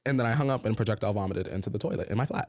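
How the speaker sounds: usual speaking voice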